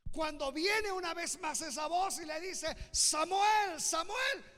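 high, loud preacher's proclamation; full-force preaching. Real, not acted. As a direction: preach it out to the whole congregation at full voice.

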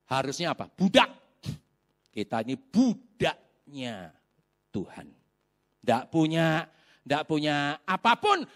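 ordinary talk

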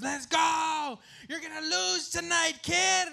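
high, loud heckling yell; heckling shout. Retold or imitated. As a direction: taunt the kid from the stands. Rival fan taunting him.

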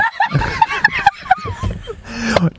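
long, high laughing fit; high-pitched real laughter; helpless laugh. Real, not acted. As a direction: crack up uncontrollably. Host cracking up mid-sentence.

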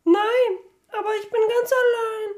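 put-on high, small girl's voice, stammering; little girl. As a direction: voice a lost little girl, halting. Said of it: "stammelte die Kleine".

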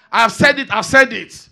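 loud, firm mock insistence; stubborn retort. Preacher imitating a stubborn man insisting, loud. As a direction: slam it down as final. Mimicking hardcore person.

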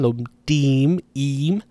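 lomdim with a drawn-out final ee, then im alone; long vowel. Shown as a demonstration. Teacher isolating long vowels, lomdim and im held long. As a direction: stretch the ee in lomdim and im. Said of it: "these have long vowels in them".

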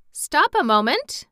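high, loud interjection; sudden exclamation. A narrator's reading. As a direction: cut in with an urgent exclamation. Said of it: "exclaimed Jack".

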